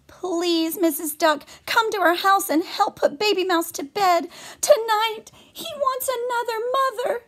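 frantic high mouse plea; pleading mother mouse. Acted. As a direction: beg for help, high and urgent.

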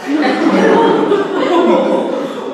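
big burst of real laughter, falling away; loud real laugh. Real, not acted. Laughs at own anecdote. (lautes echtes Lachen)

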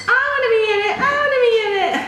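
put-on high, loud pleading child voice; kids begging. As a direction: beg like an eager little kid.

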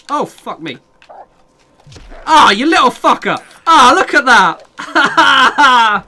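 loud, high yell at an attacker; real full-voiced shout under attack; startled yelling. Real, not acted. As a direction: yell out in sudden alarm, far above talking pitch. Suddenly attacked by animal in game.

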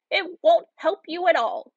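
high-pitched witch's dismissal; scornful old woman. A narrator's reading. Witch to weeping Gretel, high scornful dismissal. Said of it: "said the old woman".

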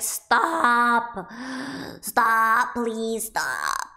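loud, drawn-out mock plea; exasperated pleading. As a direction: beg loudly as if fending off spoilers. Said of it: "I'm like".

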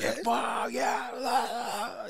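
high, slowed mock yelling of a ranting man; imitating a street yell. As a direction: ham up a stranger's incoherent yell. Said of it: "estaba uno gritando aquí afuera".

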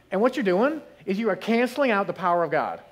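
normal talking voice

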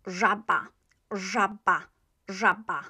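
"żaba" with a buzzed French j at the start; pronunciation demo. Shown as a demonstration. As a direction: model "żaba" as ZHA-ba. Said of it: "This one is".

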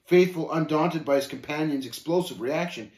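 plain reading voice

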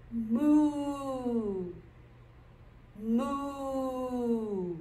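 long, held cow moo. Shown as a demonstration. Instructor answering what the cow says, long moo. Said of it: "What does the cow say?".

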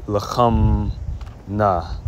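'lacham na' split, ending on open ah; wrong feminine form. Shown as a demonstration. Teacher shows wrong feminine, 'lacham na' with open ending. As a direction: say 'lacham', pause, then bare 'na'. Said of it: "you're not gonna say".

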